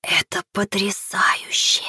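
slow, soft unvoiced whisper. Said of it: "прошептал оператор".

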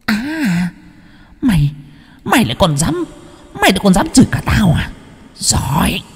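high, fast furious ghost voice; teeth-gritting spirit. A narrator's reading. Spirit gritting its teeth at the man who cursed it. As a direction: grind out threats through teeth. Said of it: "nghiến răng nghiến lợi".

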